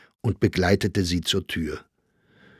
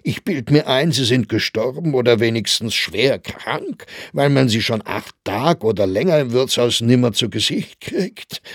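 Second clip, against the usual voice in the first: higher, louder teasing Viennese crony; teasing Grossinger; 4 semitones higher, 5 dB louder than usual. A narrator's reading. Grossinger teases Saxberger about his absence, mock-jovial. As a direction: needle him with a knowing laugh.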